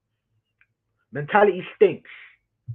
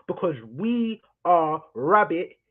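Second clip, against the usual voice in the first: ordinary delivery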